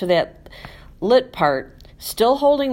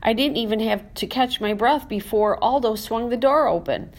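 ordinary delivery

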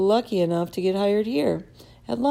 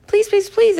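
high, louder pleading squeak; 6 dB louder than usual. A narrator's reading. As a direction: squeak it out, begging. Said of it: "I squeaked".

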